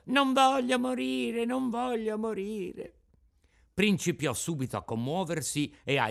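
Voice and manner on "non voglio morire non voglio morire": high, slow terrified wail that fades off; desperate screaming. A narrator's reading. Pinocchio, carried before the puppeteer, wails in terror. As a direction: beg for your life, pleading and scared.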